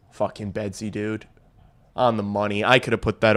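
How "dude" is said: ordinary delivery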